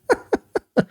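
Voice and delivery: sudden high squeal of a laugh; real laugh breaking out high; high burst of laughter. Real, not acted.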